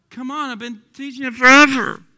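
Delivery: exasperated preacher, bursting out on "forever"; exasperated outburst. Real, not acted. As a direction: build to a loud shout on "forever". Exasperated reply to imagined question.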